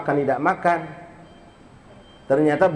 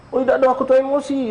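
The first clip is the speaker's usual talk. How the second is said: hasty excuse; 7 semitones higher, much faster than usual. Retold or imitated.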